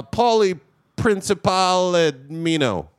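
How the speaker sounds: slow, drawn-out Italian-sounding name; fake Italian name. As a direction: stretch out the made-up Italian name. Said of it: "One more made-up fake Italian name".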